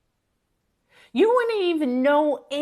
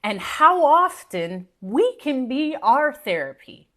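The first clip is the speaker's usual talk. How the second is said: ordinary delivery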